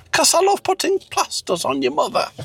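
high-pitched character voice; high, eager answer; Cecil, chatty. Acted. Plays Cecil.

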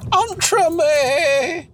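put-on high hammy French accent; crooning mock French lover; fake foreign language. Mock French couple role-play.

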